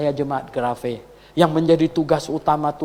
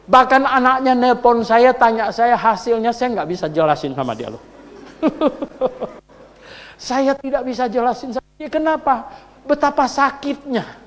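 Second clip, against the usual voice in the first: higher, louder preacher voice; fiery preaching; 9 semitones higher, 5 dB louder than usual. Acted. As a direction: preach it with passion. Preaching at full force. (berkhotbah dengan semangat)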